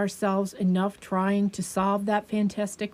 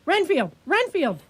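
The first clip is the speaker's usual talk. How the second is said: calling out; 7 semitones higher than usual. Acted. Doctor calling out over struggle.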